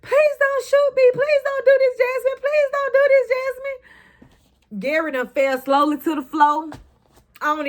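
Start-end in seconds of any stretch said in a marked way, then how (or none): 0.0-4.8 very high, loud terrified begging; high loud hollered begging not to be shot; screaming plea. Retold or imitated. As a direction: scream for your life at the gun. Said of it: "Andy screaming, hollering".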